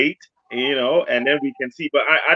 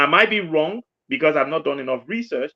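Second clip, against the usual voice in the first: ordinary delivery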